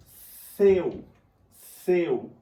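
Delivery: "seu" alto, com o E forte e o U fraco; ênfase no E. Shown as a demonstration. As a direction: carregue no E de "seu". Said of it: "O meu E sai com mais intensidade". ("seu" com o E mais intenso)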